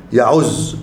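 يعز said ya-UZZ, with an u after the ayn; damma on the ayn. Shown as a demonstration. Teacher showing the present-tense verb form, يعز with damma on the ayn. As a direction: put a short u on the ayn in يعز. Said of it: "بضم العين".

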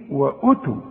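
"وأتوا" with a brief first u; correct short u. Shown as a demonstration. Teacher corrects the reading, short first vowel. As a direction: keep it wa-utu, no drag on "وأتوا". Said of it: "اوعى تقول وأوتوا".

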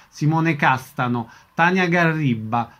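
ordinary talk, nothing marked

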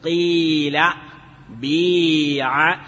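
قيل and بيع with a clean i, بيع drawn out very slowly; pure-kasra reading of قيل and بيع. Shown as a demonstration. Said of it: "الكسر الخالص بأن نقول".

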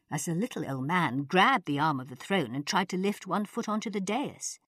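plain reading voice

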